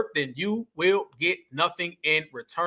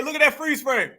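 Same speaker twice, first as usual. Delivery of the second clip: ordinary talk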